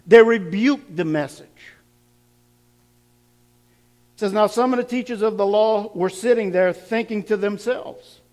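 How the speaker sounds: preaching at full force. Acted. Pastor urging his congregation, full preaching voice. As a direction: hammer the point home to the congregation.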